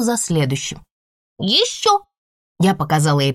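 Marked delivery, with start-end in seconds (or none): 1.4-2.0 put-on high greedy toddler; demanding toddler. A narrator's reading. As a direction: demand more candy. (требовательный малыш)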